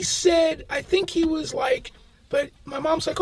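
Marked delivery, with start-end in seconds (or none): none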